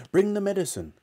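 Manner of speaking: high, urgent call; eager yell. Acted. Mister Cranky grabbing George, high and urgent. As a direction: hurry him along. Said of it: "Mister Cranky yelled".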